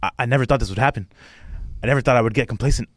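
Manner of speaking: quick, earnest self-pitying rant; fast rant. Retold or imitated. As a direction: rattle it off like a confession. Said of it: "ranting about".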